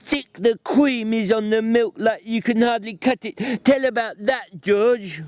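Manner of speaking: Lenny finishing the familiar lines, overjoyed; Lenny, delighted. A narrator's reading. Lenny begging for the story.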